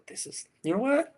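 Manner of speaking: jumps way up high, a stunned happy squeal; delighted surprise. Retold or imitated. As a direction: act floored and thrilled by the praise. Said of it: "we go".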